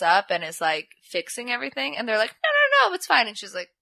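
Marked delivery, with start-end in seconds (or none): 2.4-3.3 very high, loud placating squeak; hasty mock reassurance. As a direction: imitate nervous guys insisting, way up high. Retold guys hastily reassuring her.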